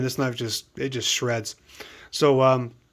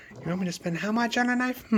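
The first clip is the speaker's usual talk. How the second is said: higher, softer mock fiance; 6 semitones higher, 7 dB quieter than usual. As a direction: imitate her disbelief, high and soft. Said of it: "she's like".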